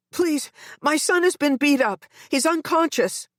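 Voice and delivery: high, urgent pleading; frightened mother on the phone; panicked. A narrator's reading. Mother to the operator, panicked and high.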